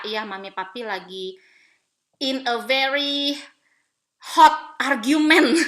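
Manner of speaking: slow, building to a loud 'hot argument'; drawn-out emphatic confession. Retold or imitated. Mother admitting a fight to her kids, drawn-out emphasis. Retelling her update to children.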